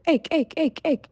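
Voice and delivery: high, sharp frog croaks; croaking frogs. A narrator's reading. Frogs crying at the peasant from the pond, high croaks. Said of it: "The frogs, however, stood to their".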